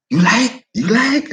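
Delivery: high, eager broken English; imitated Vietnamese English. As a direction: coax the guest to eat. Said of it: "they'll look at me like".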